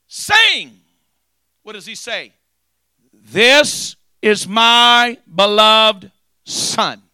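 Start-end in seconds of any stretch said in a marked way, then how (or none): 0.1-0.8 sudden high booming call. Acted. Preacher booming the voice from heaven. 3.1-7.0 slow, loud, high booming proclamation; voice from heaven. Acted. Preacher voicing God over the Jordan, slow and booming.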